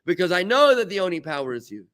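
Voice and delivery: high, loud cry of l'chaim, slowing on the insult; defiant joyful toast. Retold or imitated. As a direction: raise a glass to God and laugh off the enemy. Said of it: "He wants to see if I can say".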